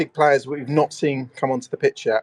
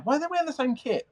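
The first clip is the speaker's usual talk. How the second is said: surprised; 11 semitones higher, 3 dB quieter than usual. Real, not acted. Baffled at matching kits.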